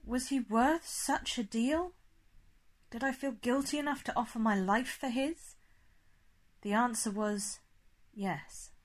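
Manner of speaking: ordinary delivery, nothing marked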